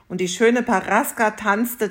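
ordinary delivery

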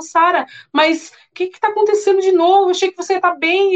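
husband baffled that his wife is crying again; exasperated husband. Retold or imitated. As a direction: throw up your hands at her crying again.

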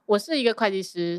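ordinary delivery

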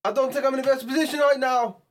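loud, high mock Australian shout; Australian surfer yelling in alarm; Australian accent. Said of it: "I don't know what accent. He was doing, like, an Au-, Australian".

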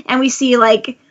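ordinary talk

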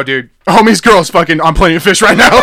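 high, loud blurt breaking into laughter; loud mock gossip. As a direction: broadcast the secret, half laughing. Acts out blurting a secret.

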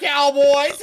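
loud, high cowboy holler; mock American cowboy. Teasing friends with a catchphrase, mock American cowboy holler.

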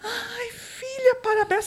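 high, slow tearful mother imitation; mother's crying voice. Mother congratulating daughter on first period, tearful. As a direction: mimic a weepy proud mom. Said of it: "aí ela".

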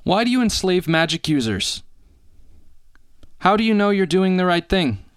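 high, rising desperate questions; pleading protest. Acted. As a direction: plead your case, voice climbing. Captive Liga protests to captor.

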